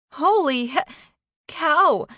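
high, loud exclamation cut short and swapped; shocked, self-correcting. A narrator's reading. Carney learns whose daughter it is, shocked and self-correcting. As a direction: blurt it loudly, catch the swear midway. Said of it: "Carney quickly corrected herself".

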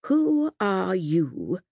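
very slow, quiet menace; slow and soft. Acted. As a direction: draw it out softly, threatening. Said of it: "so slowly, so softly".

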